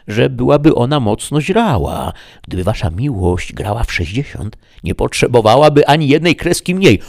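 a hint of a pleading peasant asking a favour; Sancho the squire. A narrator's reading. Sancho asking the housekeeper to stable his donkey, lightly pleading.

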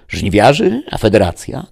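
questioning. A narrator's reading. Snake operator questioning the chief's order, puzzled. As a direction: query the order, pitch jumping up. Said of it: "dopytywał wężowy".

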